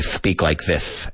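slow demo lisp, every S in "speak like this" said as TH. Shown as a demonstration. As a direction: lisp it: "speak like this" as thpeak like thith. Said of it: "when you speak like this".

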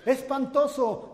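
loud, drawn-out high yell; boy bellowing an insult; child's shout. Retold or imitated. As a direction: scream "¡Espantoso!" slowly into the cave. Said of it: "el niño gritó".

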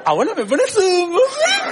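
hammed-up affected voice asking grandma for a muffin; mock posh request. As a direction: play the fancy grandson asking granny. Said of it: "y le digo".

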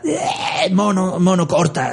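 high, shrieking mock panic; a victim's hammed-up yelp; panicked cry. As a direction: beg the ape to stop, screaming. [name] crying out in panic.

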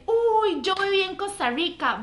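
mock the guy's eager surprise; excited. Excited Argentine man voiced. (imitando con entusiasmo al muchacho)

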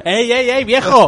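laughing shout. Acted. Scolding the sandwich thief.

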